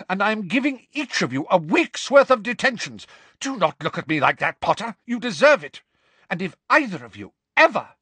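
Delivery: high, forceful telling-off; stern fury. Acted. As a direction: lay down the law in outrage. Furious professor sentences pupils to detention.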